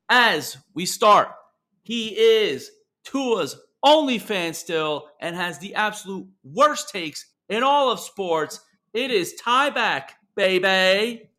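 big, slow show-host welcome; announcer voice. Acted. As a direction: hype up the show open like a radio announcer. Host introduces co-host.

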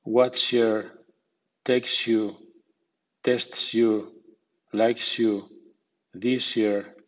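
"this year" said as "thishyear"; sh blend. Shown as a demonstration. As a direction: run s and y together into sh: "likes you" like "likeshu". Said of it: "S sorda más I suena sh".

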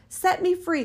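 high pleading cry. A narrator's reading. Bottled spirit pleads with the scholar, high cry. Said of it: "cried the spirit".

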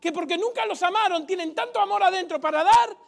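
fiery preaching. Acted. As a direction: preach it to the crowd. Preaching at full force.

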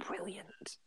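breathy whispered praise. Real, not acted. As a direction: whisper it like a secret.

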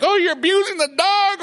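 mocking offended people. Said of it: "people nowadays would be like".

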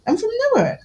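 very high-pitched, retold obtuse answer; mock-innocent insistence. Shop worker stonewalling a prying customer, high mock-innocent reply.

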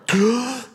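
sharp high gasp of a shocked room; imitated crowd gasp. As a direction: gasp like a whole room at once.

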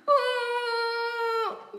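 put-on loud, high drawn-out child's wail; bawling wail. A narrator's reading. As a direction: howl like a little boy crying. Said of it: "brult kleine Jan".

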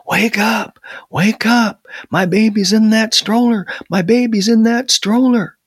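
high, loud cries to rouse a sleeper; urgent yelling. A narrator's reading. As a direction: yell in panic, pitch way up. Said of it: "He started yelling".

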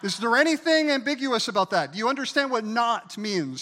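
high, loud rhetorical challenge; preaching shout. Real, not acted. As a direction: fire the question at the crowd. Preacher challenges congregation loudly.

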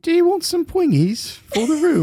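very high, silly British accent; squeaky over-the-top British voice. Shown as a demonstration. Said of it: "wanna do the accent".

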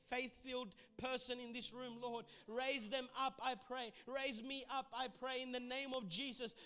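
fervent rising intercession; rally the room in prayer; rally speech. Acted.

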